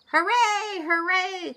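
high, loud hoorays; cheering. A narrator's reading. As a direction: cheer like a crowd of animals. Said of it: "cried all the animals".

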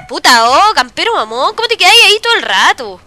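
loud complaint rising in pitch; exasperated gamer rant. Real, not acted. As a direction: rail at the camping enemy. Rants at a camping enemy.

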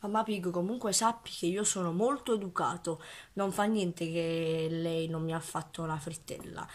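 soft, falsely polite and proper; mock polite. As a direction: quietly play the well-mannered gentleman. Mock polite calm address.

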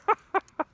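a real, sudden giggle; burst of laughter. Real, not acted. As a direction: crack up at the flubbed pencil joke. Laughs at own bad joke.

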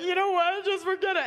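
high, huffy brush-off in a mock girly voice; high-pitched mock sulk; huffy girlfriend. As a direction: play the wounded girlfriend storming off. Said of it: "I'd be like".

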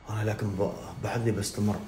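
ordinary talk, nothing marked

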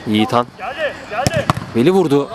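usual speaking voice

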